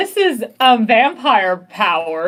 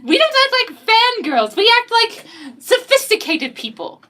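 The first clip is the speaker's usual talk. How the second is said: high and smug; high, snooty character taunt; haughty girl voice; 8 semitones higher than usual. Acted. Julie taunting rival character.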